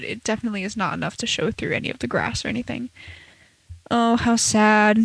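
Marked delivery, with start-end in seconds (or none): 3.9-5.0 mock sad. Sarcastic mock lament about snow.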